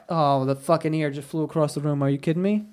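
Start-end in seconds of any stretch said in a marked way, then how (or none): none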